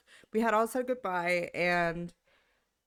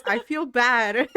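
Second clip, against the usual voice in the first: slow, louder sighing lament; sighing mom; 3 semitones higher, 9 dB louder, slower than usual. Retold or imitated. Mom sighing to her daughter about the sad friends. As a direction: heave it out on a sigh. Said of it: "like a big ass sigh".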